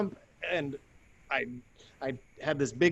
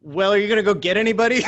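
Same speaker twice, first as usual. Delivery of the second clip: quick, high, louder exasperated plea; exasperated retort; 8 semitones higher, 9 dB louder, faster than usual. Retold or imitated. Stuck down the hole, urging the staring buddy, exasperated. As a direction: needle him to go get help.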